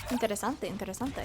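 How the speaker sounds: soft, convincing Spanish accent; musing softly in well-accented Spanish. Real, not acted. Reacting to a friend who speaks Spanish, soft Spanish accent. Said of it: "You have a really good ac-, uh, accent for Spanish".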